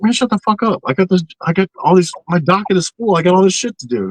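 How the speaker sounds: high-pitched exasperated pleading; worked-up, protesting mock argument; exasperated retort. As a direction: argue back at your own nagging brain, pitch jumping up. Acted retort to nagging own brain.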